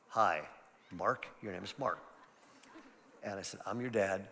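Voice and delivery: soft, low, tender greeting to a newborn; hushed and gentle, like holding a baby. Retold or imitated. Father greeting newborn son Mark, soft and tender.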